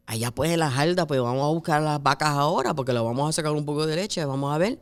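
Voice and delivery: rapid rural Puerto Rican Spanish; fast country Jíbaro accent. Retold or imitated. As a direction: imitate a grandmother's Jíbaro Spanish, words running together. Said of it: "it's the Jíbaro Spanish".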